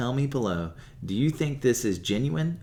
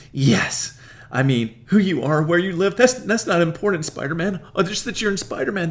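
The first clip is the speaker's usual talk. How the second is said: much higher, much louder gushing; 6 semitones higher, 6 dB louder than usual. Retold or imitated. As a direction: gush over the hero fantasy. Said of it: "she responds to this".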